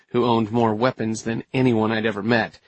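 ordinary delivery

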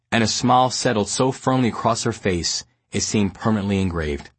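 ordinary delivery, nothing marked

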